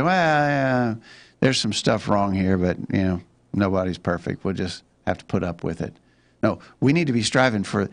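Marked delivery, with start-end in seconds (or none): 0.0-6.0 complacent. Acted. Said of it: "complacent and say".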